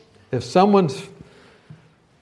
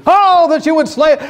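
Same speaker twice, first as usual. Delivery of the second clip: sudden high, loud mock shout; psalmist's outburst; 11 dB louder than usual. Psalmist breaks into talk of slaying, mock impassioned shout.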